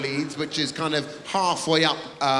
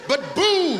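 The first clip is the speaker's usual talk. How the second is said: mock Leeds heckler; 13 semitones higher than usual. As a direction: ham up the heckler from Leeds, pitched high. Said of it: "I'm not even on the same continent".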